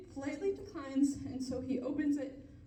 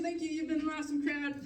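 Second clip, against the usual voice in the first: ordinary delivery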